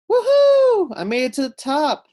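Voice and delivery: very high, loud victory whoop; joyful shout. Acted. Sophia reaching the top of the climbing wall, whooping loud and high. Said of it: "Sophia shouted".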